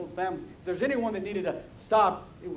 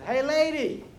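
parrot calling out. Acted. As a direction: call out like a heckling parrot. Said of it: "the parrot calls out to her".

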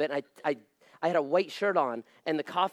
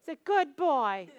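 slow, high-pitched mimicry; lightly put-on high voice, drawn out; mimicking praise; 8 semitones higher than usual. Pastor mimics praise of Daniel Tidwell as a good boy, slow high mimicry.

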